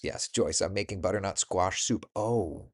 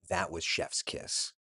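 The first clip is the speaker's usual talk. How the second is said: hushed praise; 3 semitones lower, 7 dB quieter, slower than usual. Real, not acted. Savoring memory of food.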